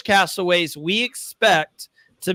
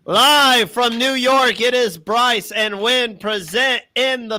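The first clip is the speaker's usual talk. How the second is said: slow, higher showman announcement; announcer voice; 5 semitones higher than usual. Acted. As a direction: open the show like a broadcaster. Announcing like a TV show.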